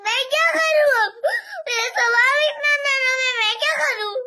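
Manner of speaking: helpless tearful wail; real crying, overwhelmed with worry. Real, not acted. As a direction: sob out your worry to mother. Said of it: "इसे रोना नहीं।".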